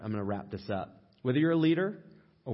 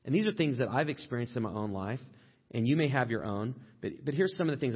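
ordinary talk

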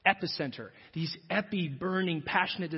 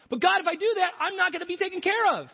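much higher, louder anxious pleading; high whiny protest; 13 semitones higher, 6 dB louder, faster than usual. Acted. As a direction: plead back in panic. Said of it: "arguing back to God".

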